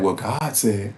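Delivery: low, soft mock believer quoting God. Vlogger mocking believers who trust scripture, low and soft. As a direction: murmur it like a trusting churchgoer. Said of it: "thinking that".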